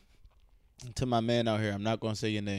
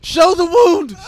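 loud, higher heckle from the back; heckling shout; 13 semitones higher, 16 dB louder, much slower than usual. Retold or imitated. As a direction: yell it out at the stage. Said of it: "be a dick from the back".

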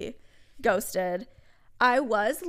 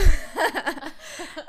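loud real laugh; burst of laughter; 6 semitones higher, 8 dB louder than usual. Real, not acted. Guest laughs at the host's mock hatred, loud laugh. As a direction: break out laughing. Laughs after being teased.

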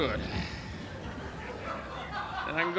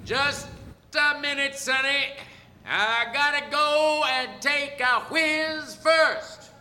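teasing call; 9 semitones higher, slower than usual. Acted. Calls out teasing the challenger.

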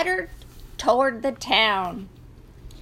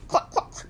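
whispered cluck; 4 dB quieter than usual. A narrator's reading. Rooster mimics hen.

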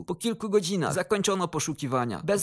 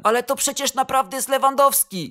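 high, raised-voice insistence; shouted protest; 7 semitones higher, 5 dB louder than usual. A narrator's reading. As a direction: cry out in disbelief, louder and higher. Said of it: "krzyknął prezes Lato". (podniesiony, wysoki okrzyk protestu)

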